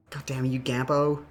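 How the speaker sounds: ordinary talk, nothing marked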